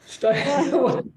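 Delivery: slow, high, laughing pun; laughing through the words. Real, not acted. Quizmaster cracking up at his own pun. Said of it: "Sorry about the dreadful pun".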